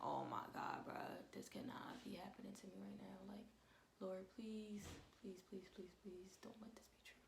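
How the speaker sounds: hushed, pleading whisper, close to tears; imitating a frantic whispered plea; desperate whispered prayer. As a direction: beg the Lord under your breath. Retold desperate prayer on hearing news.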